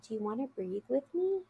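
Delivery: soft, high-pitched imitation of a child; gentle child's offer. Child to an overwhelmed friend, soft tentative offer. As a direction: creep in softly, kitty-cat careful. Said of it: "almost crawls like a little kitty cat next to her and says".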